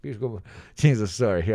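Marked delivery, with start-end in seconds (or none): 0.0-0.4 softer mock-Albanian accent. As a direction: toss it off quietly in an Albanian accent. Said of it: "not allowed to do this accent".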